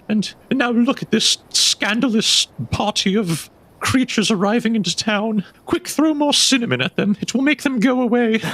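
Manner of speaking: high, flustered townswoman voice; frightened townswoman. Acted. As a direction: squeak it out like a panicky superstitious gossip.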